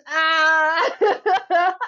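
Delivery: bright burst of laughter; delighted laugh. Real, not acted. Creator laughing at her own success. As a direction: laugh out with joy.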